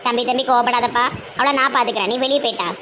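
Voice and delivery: low, soothing elder-sister tone; calming. Acted. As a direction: settle him down gently. (கோபப்பட்ட தம்பியை அமைதிப்படுத்தும் தாழ்ந்த குரல்)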